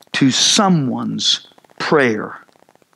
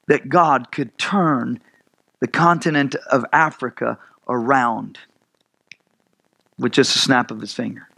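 ordinary talk

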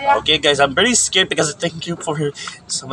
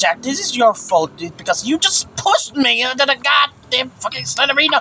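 annoyed blaming; 6 semitones higher than usual. Real, not acted. Blaming friend after scare.

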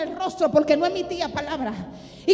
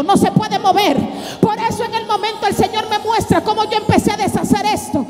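loud, high, driving sermon cry; preaching at full force; 4 semitones higher, 10 dB louder than usual. Acted. Preacher rousing the congregation to break free, loud and high. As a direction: hammer every empieza like a revival preacher.

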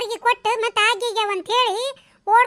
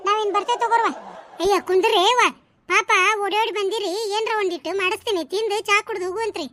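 ordinary delivery

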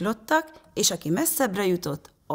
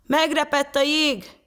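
high, raised-voice alarm; desperate cry; 9 semitones higher, 4 dB louder than usual. A narrator's reading. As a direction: call out the danger in despair. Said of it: "kiáltotta kétségbeesett hangon".

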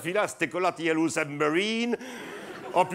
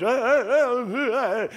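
laughing; 8 semitones higher, 4 dB louder than usual. Pretend. Reporter toasts with farmer.

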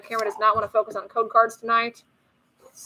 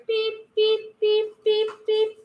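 high, evenly spaced beeps; imitated reversing beeps; 10 semitones higher, slower than usual. Parent beeping as the child backs up, playful beeps. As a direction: beep like a truck backing up.

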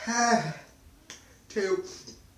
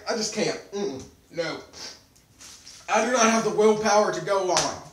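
mouth burning from spicy food; 6 dB louder than usual. Real, not acted. Giving up on peppers.

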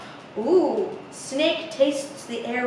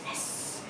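soft voiceless hiss; quiet whispered snake hiss; 16 dB quieter than usual. A narrator's reading. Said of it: "Snake can hiss".

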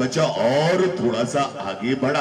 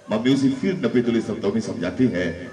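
normal talking voice

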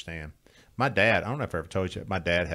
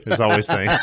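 laughing; 11 dB louder than usual. Real, not acted. Laughing through line.

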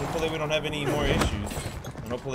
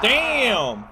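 loud, falling damn; loud surprised shout; 9 semitones higher, 8 dB louder than usual. Real, not acted. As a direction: shout it in shock. Reacting to cutscene.

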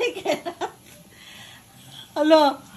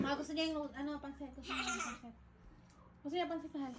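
soft murmured aside; hushed comment to husband; quiet aside; 4 semitones lower, 19 dB quieter, faster than usual. Real, not acted.

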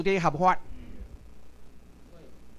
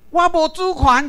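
higher, louder and slow, like a grand proclamation; mock America's claim; 7 semitones higher, 7 dB louder than usual. Lecturer mimicking America to the audience, a loud slow mock declaration. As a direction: declare it slowly and loudly as if you were America. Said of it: "美国讲讲".

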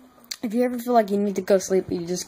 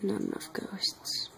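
whispered, barely there, drained; faint worn-out whisper; exhausted whisper; 4 semitones lower, 12 dB quieter than usual. Real, not acted. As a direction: whisper like you're out of energy. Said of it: "so tired".

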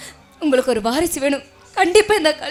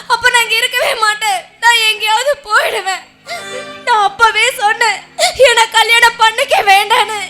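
loud, distraught ultimatum; tearful reproach; 2 semitones higher, 8 dB louder than usual. Acted. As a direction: raise your voice and scold him through tears. Wife threatens and reproaches husband.

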